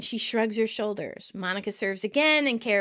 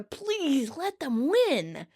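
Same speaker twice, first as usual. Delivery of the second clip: slow, higher-pitched plea; Chandler begging; 5 semitones higher, much slower than usual. Retold or imitated. Chandler begging Monica to lose, long-drawn plea. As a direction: imitate begging, dragging out "please". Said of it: "then begs Monica".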